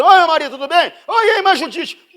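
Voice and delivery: high, bright greeting at a reunion; mock-cheerful churchgoer; cheerful greeter.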